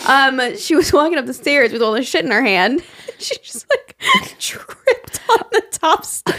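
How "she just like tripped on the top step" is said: slow, high words squeezed through giggles; real breathless laughing fit; helpless laughter. Real, not acted. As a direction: barely get the story out for laughing. Too amused to get story out.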